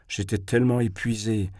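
ordinary delivery

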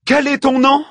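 high, loud challenge; commanding demand. A narrator's reading. Queen confronts filthy looter, commanding demand.

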